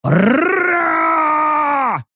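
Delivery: long high roar with rolled r; battle roar. A narrator's reading. Orc Rek lunges at the bear, roar. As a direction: roar it out, rolling and stretching.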